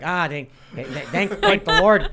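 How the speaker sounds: put-on loud, high Chicago accent, relieved. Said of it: "please do your Chicago accents".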